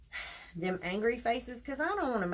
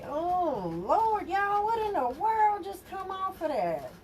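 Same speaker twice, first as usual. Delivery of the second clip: much higher and louder, caught off guard; startled exclamation; 11 semitones higher, 4 dB louder than usual. Real, not acted. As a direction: cry out at the surprise on the mesh. Finds something on the mesh.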